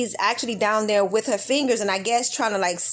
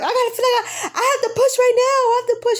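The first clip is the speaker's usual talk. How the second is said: high loud desperate cry; urgent cry; 13 semitones higher, 4 dB louder, faster than usual. Retold or imitated. Mother in labor telling doctor she must push now, high loud cry. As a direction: it can't wait any longer.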